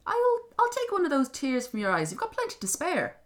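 high, loud imp voice; name your price like a sly leprechaun; bargaining little creature. Acted. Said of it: "the little thing said".

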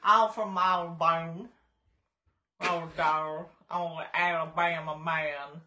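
mock Nicolas Cage Southern accent. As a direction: lean into a bad Southern movie accent, slowly. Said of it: "his terrible accent".

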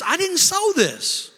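high, loud, drawn-out protest to God; pleading outcry. Acted. Preacher voicing a believer's honest complaint to God, frustrated outcry. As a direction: cry out in frustrated bewilderment.

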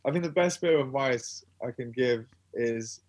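ordinary delivery

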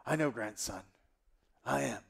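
lightly voiced, self-satisfied granddad; storytelling grandfather. Grandfather accepting grandson's praise, lightly acted old storyteller. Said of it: "tell us the story".

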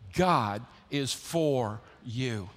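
slow, weighty, each word spaced out; slow call and response. Shown as a demonstration. Preacher leading the church in unison, slow and weighty. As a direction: lead the congregation word by word. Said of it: "Say it with me".

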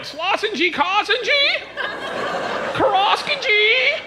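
high whiny gibberish. Retold or imitated. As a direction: whine like a fussy kid.

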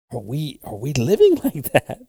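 ordinary talk, nothing marked

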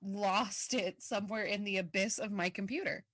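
ordinary delivery

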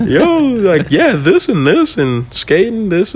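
high, drawn-out sing-song mockery; mock-enthusiastic rambling, high and stretched; mock eager chatter. Mocks gushing about his own life.

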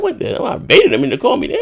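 sharp high squeak of outrage, then dropping; quoting my own shocked reaction; mock disbelief. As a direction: spit it out like you can't believe it. Incredulous retort to brother.

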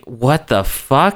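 slow, baffled drawl. Retold or imitated. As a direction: stretch out the confusion. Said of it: "And then Kevin's like".

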